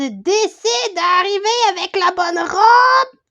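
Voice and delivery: building from a grumble to a drawn-out loud cry; pointed exasperation. Acted. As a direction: raise it steadily and stretch the last words out loud. Hints loudly at the offender.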